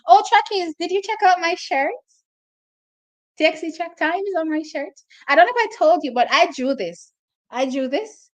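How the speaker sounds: bursting out high and loud, thrilled; excited. Real, not acted. Vlogger shows fans the shirt she drew, excitedly. Showing viewers her own shirt.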